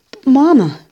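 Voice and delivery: high, loud plea; loud protest. A narrator's reading. Boy protesting to Mama again, high and loud. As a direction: cry out against her.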